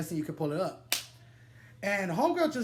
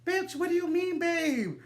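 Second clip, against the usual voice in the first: high, incredulous "what do you mean, babe?"; outraged; 11 semitones higher than usual. Retold or imitated. Confronting the man who called his girl babe, high outrage. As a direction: demand an answer, shrill with outrage. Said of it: "I'm like".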